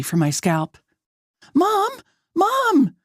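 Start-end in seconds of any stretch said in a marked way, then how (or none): 1.5-3.0 very high frantic cry for mom; high-pitched, frantic sister's cry; frantic scream. A narrator's reading. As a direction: call out in panic for help. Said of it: "Ava starts screaming frantically".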